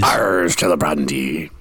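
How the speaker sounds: slow pirate growl on Ars Celebrandi, rolling Arrs. Pretend. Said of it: "that's a pirate's favorite liturgical, uh, phrase".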